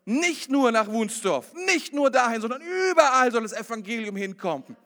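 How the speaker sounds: loud, high, driving sermon climax; full-force preaching. Acted. Pastor thundering blessing over the congregation, loud and high. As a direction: preach it out to the whole church.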